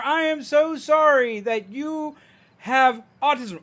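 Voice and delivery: high, loud, slow mock sympathy; overdone condescending sorry, imitating strangers; loud obnoxious pity. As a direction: drag out the fake pity, loud and syrupy. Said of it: "they talk like that. It's loud and obnoxiously done".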